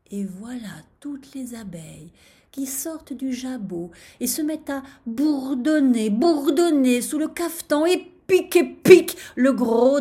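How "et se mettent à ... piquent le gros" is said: building from lively telling to loud, raised pitch; mounting excitement. A narrator's reading. Narrator tells the bees' attack, mounting excitement. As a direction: swell with each repeated word like a growing swarm.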